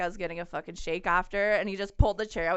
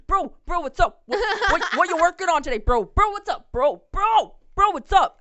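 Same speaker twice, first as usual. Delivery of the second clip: high, loud, pestering gym-bro impression; 9 semitones higher, 10 dB louder than usual. Imitating the chatty guy working the gym, mock gym bro. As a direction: hammer out each greeting, high and loud. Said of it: "walks around with his bag".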